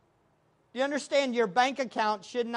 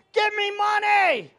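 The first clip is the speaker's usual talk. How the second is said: loud, high mock demand; imitated screaming; 8 semitones higher, 6 dB louder than usual. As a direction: yell it like a frenzied preacher. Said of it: "he was screaming".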